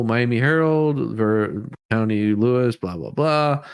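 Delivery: ordinary talk, nothing marked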